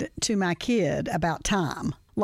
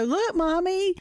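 put-on child voice; 9 semitones higher, slower than usual. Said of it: "they'll go".

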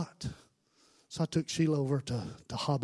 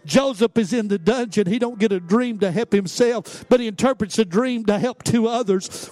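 raised, insistent preacher's voice; fervent altar-call preaching; 8 semitones higher, 9 dB louder than usual. Real, not acted. Preacher calling hurting people to the altar, fervent preaching. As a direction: press each line on the congregation with steady fervor.

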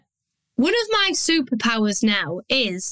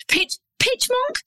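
much higher, squeaky "Pitch, pitch monk?" like a chipmunk; 13 semitones higher than usual. Shown as a demonstration. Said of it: "I sound a little bit like a high-chipped pitch monk".

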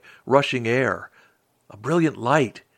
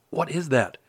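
ordinary delivery, nothing marked

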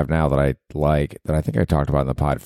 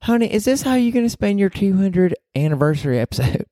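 very high put-on Southern belle voice; Southern girlfriend; 14 semitones higher than usual. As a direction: do a falsetto Southern sweetheart. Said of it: "this is your girlfriend talking to you".

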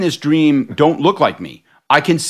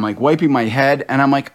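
normal talking voice

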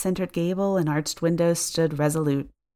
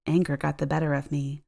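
usual speaking voice

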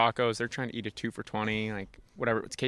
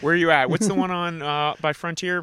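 louder and higher, hammed-up taunt; mock tough-guy challenge; 6 semitones higher, 9 dB louder than usual. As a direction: pick a fight with the old man, cocky. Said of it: "I mean, I was like".

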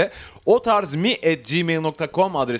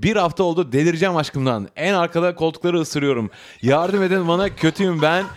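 reading a listener's letter, lively and animated; letter read aloud; 3 semitones higher than usual. Acted. Host reading a listener's letter to the audience. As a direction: tell her story like it's your own.

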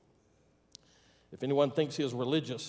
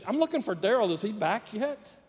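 higher-pitched, chirpy phone enquiry; innocent caller; 7 semitones higher than usual. Acted. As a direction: ask as if nothing is wrong. Caller persists, playing innocent.

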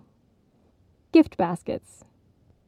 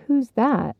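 ordinary delivery, nothing marked

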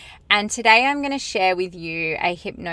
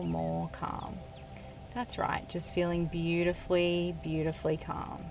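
soothing trance induction, unhurried and hushed; guided hypnosis; 6 dB quieter than usual. Acted. Leads a hypnosis session.

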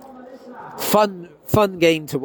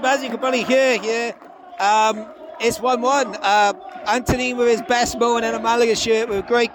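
raised over crowd; 7 semitones higher than usual. Real, not acted. Loud stadium crowd behind.

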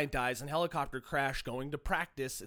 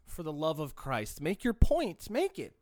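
ordinary talk, nothing marked

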